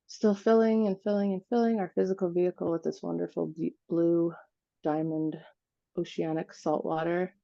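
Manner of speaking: unhurried meditation voice with long pauses; guided meditation. Acted. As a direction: guide them gently through it, phrase by phrase, leaving space to breathe.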